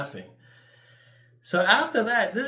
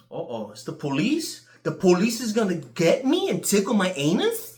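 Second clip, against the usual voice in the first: louder, higher hammed-up panic; mock scared; 5 semitones higher, 6 dB louder than usual. Joking to viewers about police sirens outside, mock panic. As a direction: fake alarm at the sirens.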